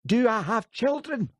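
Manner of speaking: high, loud screeching question; outraged mother screeching at the policeman. A narrator's reading. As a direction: shriek it back at him. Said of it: "she screeched".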